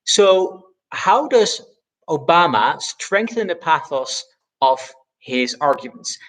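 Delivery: normal talking voice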